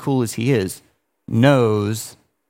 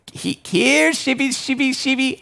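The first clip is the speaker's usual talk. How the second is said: silly sheep call; 11 semitones higher than usual. Pretend. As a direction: coax imaginary sheep in a tiny high voice. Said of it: "you'd be like".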